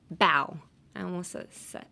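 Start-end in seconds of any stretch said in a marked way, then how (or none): 0.1-0.6 bow rhymes with now, not no; bow said like cow, crisp B; bow with the ow of ouch; bow as in bending. Shown as a demonstration. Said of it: "you pronounce it".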